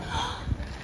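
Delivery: sharp voiceless gasp; retold winded gasp. Woman acts out landing hard, gasping.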